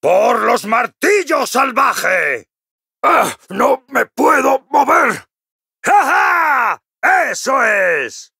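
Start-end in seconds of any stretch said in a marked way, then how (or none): 0.0-2.5 high, drawn-out war shout; battle cry. Acted. Dwarf charging for his hammer clan, proud war cry. 3.0-5.3 high, strained cry; alarmed struggle. Acted. Dwarf stuck fast in battle, high strained alarmed shout. 5.8-6.8 high, exultant ha-ha; triumphant laugh. Acted. Dwarf winning the fight, high triumphant laugh.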